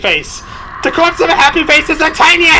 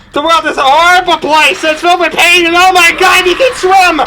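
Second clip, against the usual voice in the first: loud, higher mock terror; hammed-up panic; 4 semitones higher, 3 dB louder than usual. Gamer fleeing a swimming monster, hammed-up panic. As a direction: shout it like the world is ending.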